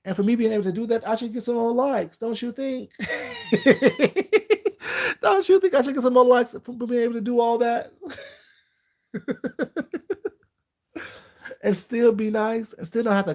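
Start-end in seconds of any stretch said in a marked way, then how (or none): 2.9-5.2 high burst of laughter; a real high laugh at her own joke. Real, not acted. 9.1-10.3 a short high laugh; a quick real laugh. Real, not acted. Laughing at own joke.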